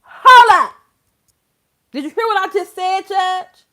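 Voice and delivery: loud, high indignant shout; hammed-up yell of objection; acted courtroom outburst. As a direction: holler to stop the judge mid-hearing. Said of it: "I would be in there like".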